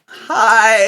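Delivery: high, loud strangled hi; starstruck squeak. Retold or imitated. Greeting the actress he admires, squeaky starstruck. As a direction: blurt a giddy hi.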